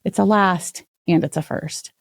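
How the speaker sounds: ordinary talk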